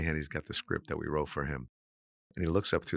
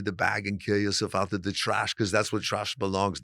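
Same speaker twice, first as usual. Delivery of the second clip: Hans bodybuilder voice; 2 semitones higher than usual. Retold or imitated. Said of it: "we're talking like Arnold Schwarzenegger".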